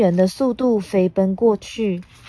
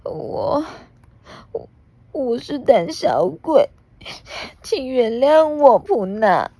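crying apology; 6 semitones higher than usual. Acted. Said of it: "边哭边往前走".

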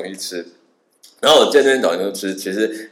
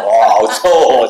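slow, louder, drawn-out disgust; 7 dB louder, slower than usual. Retold or imitated. Tourist recoils from camel spit, slow disgusted. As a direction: recoil at the stink.

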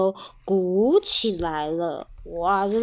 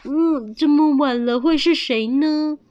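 raised, wondering question; mildly puzzled; 5 semitones higher, 5 dB louder than usual. Acted. Hearing knocks late at night, mildly puzzled. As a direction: puzzle aloud over an unexpected caller.

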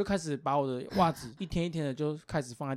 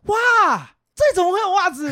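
high, loud, hammed-up gasp of discovery; mock surprise; 12 semitones higher, 9 dB louder than usual. To the partner who leaves socks around, a staged loud surprise. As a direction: play dumb, amazed to find socks. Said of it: "我就这样".

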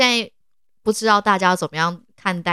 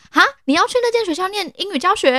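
high, fast astonished repeat-back; repeated exclamation; 13 semitones higher than usual. Retold or imitated. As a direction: echo her words in total disbelief. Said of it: "你把他吓坏了欸".

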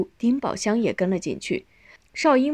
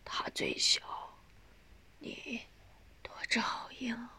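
barely audible, halting whisper; faint dying voice; 4 semitones higher, 16 dB quieter than usual. A narrator's reading. Dying mother entrusts youngest to elder son, faintly. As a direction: breathe out each phrase with failing strength.

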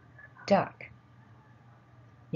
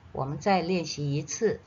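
normal talking voice

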